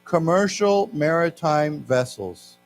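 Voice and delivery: slow, phrase-by-phrase class reading; slow reading aloud. Shown as a demonstration. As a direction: read it slowly with long pauses for learners. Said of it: "let's read this".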